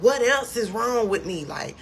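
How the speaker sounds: high, loud pleading outburst; distraught demand. Retold or imitated. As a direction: demand answers, worked up but not sobbing. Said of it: "I just start crying even more".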